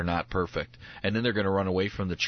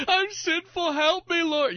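much higher, hammed-up pleading to the Lord; mock desperate prayer; 4 dB louder, slower than usual. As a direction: ham up a frantic sinner's plea.

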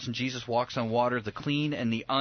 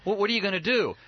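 higher, stammering mock disbelief; acted bewilderment; 6 semitones higher than usual. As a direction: sputter like a baffled disciple. Disciples baffled by Jesus feeding crowd.

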